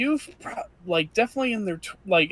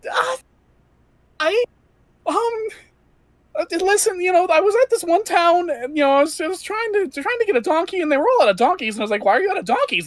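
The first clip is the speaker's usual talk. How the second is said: put-on higher, jittery young adventurer voice; nervous young Jack; 10 semitones higher, faster than usual. Jack tells his story in character.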